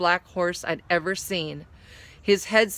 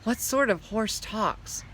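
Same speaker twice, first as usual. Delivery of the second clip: usual speaking voice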